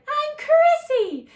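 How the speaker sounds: put-on high, slow girly voice. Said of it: "And she's like".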